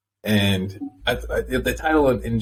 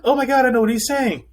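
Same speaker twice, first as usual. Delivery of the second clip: high gushing dog-owner voice, much higher and louder; mock dog owner; 11 semitones higher, 4 dB louder than usual. Mocking dog owners who claim to understand their pets. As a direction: gush about your dog in a silly high voice. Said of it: "So they have these folks who are like".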